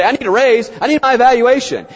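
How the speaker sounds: high, loud demanding employee; savvy employee. Retold or imitated. Employee asking for a raise in disguise, high and loud. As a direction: insist on it like a staffer angling for a raise. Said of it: "They don't say".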